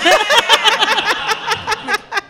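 high burst of laughter. Real, not acted. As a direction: crack up at the memory. Laughing at own story.

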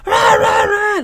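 high heavy metal shouts; metal growl shout. Shown as a demonstration. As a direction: imitate a metal singer yelling. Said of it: "what kind of band".

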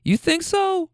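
very high-pitched doubtful granny; doubting granny. Retold or imitated. Granny doubting the driver, high mock skepticism. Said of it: "And she says".

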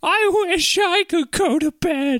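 high, slow, self-pitying moan; despairing wife voice. Acted. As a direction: whine it out in misery.

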